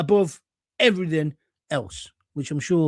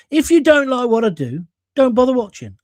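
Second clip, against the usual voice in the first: louder, higher, daring critics to leave; defiant; 6 semitones higher, 6 dB louder than usual. Real, not acted. Streamer to his critics, defiant and loud. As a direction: tell haters to stop watching, defiant.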